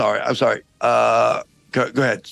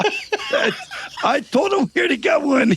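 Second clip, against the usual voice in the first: higher, hammed-up French accent; 5 semitones higher than usual. French guard calling down from the castle wall, hammed-up French accent. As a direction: ham up a silly French guard. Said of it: "this outrageous accent".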